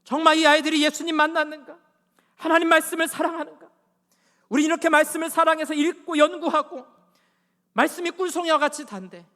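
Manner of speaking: high, loud preacher's cry; full-force pulpit appeal; impassioned preaching. Real, not acted. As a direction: challenge the congregation with ringing rhetorical questions.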